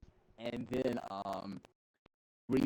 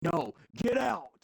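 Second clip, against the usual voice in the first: mock shout; 11 semitones higher, 6 dB louder, slower than usual. Staff throwing them out.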